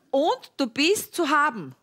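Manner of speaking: usual speaking voice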